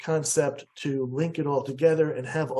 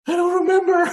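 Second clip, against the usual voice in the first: much higher, louder comic voice of mom; mom's blank reply; 7 dB louder, faster than usual. Retold or imitated. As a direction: play the mother cheerfully admitting she forgot. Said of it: "she goes".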